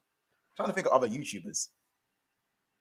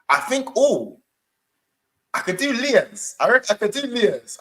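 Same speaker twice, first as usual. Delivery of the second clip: impression of a YouTuber; 8 semitones higher, 6 dB louder than usual.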